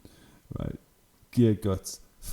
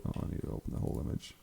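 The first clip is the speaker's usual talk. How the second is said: quick whispered aside; 8 semitones lower, 11 dB quieter, much faster than usual. Real, not acted. Aside while fiddling with screen.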